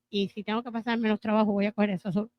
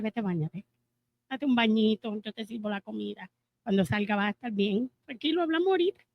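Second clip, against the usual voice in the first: much softer, gentle and reassuring; calm him down like easing a tired child; soothing; 5 dB quieter than usual. Pretend. Wife sending her tired husband to shower, soft and soothing. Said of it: "O tengo la opción de decirle".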